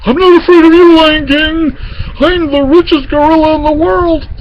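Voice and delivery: very high, frightened boasting gorilla; trembling bravado. A narrator's reading. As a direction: boast loudly while scared stiff. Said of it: "was trembling".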